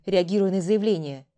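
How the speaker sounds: ordinary delivery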